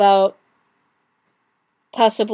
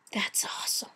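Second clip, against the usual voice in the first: imitated hushed, whispered amazement; awed whisper; 14 dB quieter than usual. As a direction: whisper it as if awestruck. Coach's awed reaction, retold.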